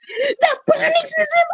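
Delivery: very high, gleeful squeal of welcome; excited squeal. Real, not acted. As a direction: squeal with delight as fans arrive. Excited squeal greeting arriving viewers.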